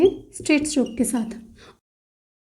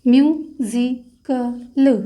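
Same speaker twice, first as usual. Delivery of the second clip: Musical stretched very slowly, syllable by syllable; drawn-out word; 2 semitones lower, much slower than usual. Shown as a demonstration. Teacher tracing shorthand stroke for students, very slow. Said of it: "तो stroke create होगा".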